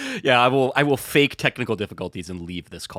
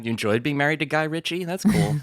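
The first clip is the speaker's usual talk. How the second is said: mock interviewer; 3 semitones higher than usual. Mocking chat with [name].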